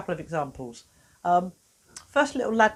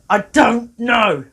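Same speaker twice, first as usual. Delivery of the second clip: I don't know stretched out slowly and louder; drawn-out sulk; 3 semitones higher, 10 dB louder, much slower than usual. Retold or imitated. Boy groaning his answer at the therapist, slow and loud. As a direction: drag out "I don't know" like a fed-up kid. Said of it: "But what he did was he went".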